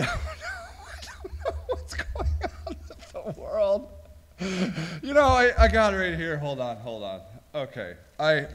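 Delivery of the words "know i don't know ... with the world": soft, slow words collapsing into high laughter; breaking into laughter. Real, not acted. Host baffled by the world, breaking into laughter. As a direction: try to speak and dissolve into laughing.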